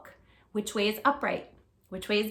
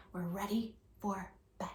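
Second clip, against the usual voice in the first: whisper; 3 semitones lower, 15 dB quieter than usual. Shown as a demonstration. Bedtime story read to children.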